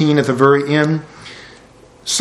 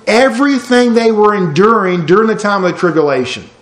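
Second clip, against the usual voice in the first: raised, impassioned preaching voice, higher throughout; preaching at full force; 4 semitones higher than usual. Acted. Pastor preaching to his congregation about heaven, impassioned and raised. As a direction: drive the message home to the congregation.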